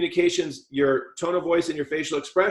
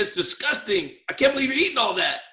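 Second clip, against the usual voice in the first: higher and fast, a hammed-up disgusted wife; mock disgust; 8 semitones higher than usual. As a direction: toss it off with exaggerated revulsion. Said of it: "Look at my face".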